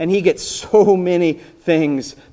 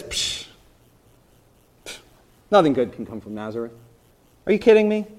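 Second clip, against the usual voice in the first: sarcastic scoff; 4 semitones lower, faster than usual. Acted. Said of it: "pretty quick with the insults, at least with the sarcasm".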